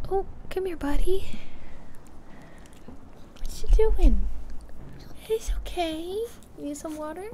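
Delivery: high cooing voice to the dog; pet voice. Real, not acted. Coaxing her dog on camera.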